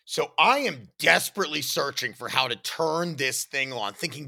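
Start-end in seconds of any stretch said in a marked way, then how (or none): none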